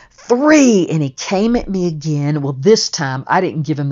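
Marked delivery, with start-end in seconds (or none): 0.1-0.9 high, loud final number; shouted count. Retold or imitated. Counting off the rematch, loud shout on three. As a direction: yell the last count to start the bout.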